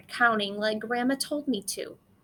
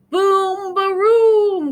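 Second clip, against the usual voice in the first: loud, high thunder crash; booming thunder; 9 semitones higher, 11 dB louder, much slower than usual. A narrator's reading. Narrator shouts the thunder crash over the farm. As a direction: boom it like the storm breaking.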